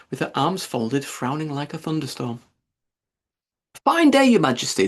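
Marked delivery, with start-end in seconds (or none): none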